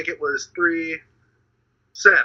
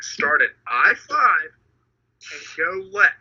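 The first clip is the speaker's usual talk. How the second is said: spelled out slowly, word by word; slow emphatic repeat; 3 dB louder, slower than usual. Retold or imitated. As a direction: say it slowly like explaining to a child. Repeating the instruction, exasperated.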